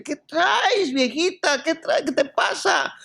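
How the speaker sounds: high, panicky questions; young driver shocked after his Mustang is rammed, high and panicky; startled young man. Acted.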